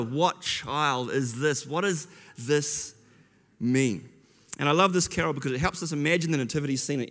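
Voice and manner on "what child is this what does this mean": slow, hushed wonder. Retold or imitated. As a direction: ask it slowly, awestruck. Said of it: "It was like, in wonder".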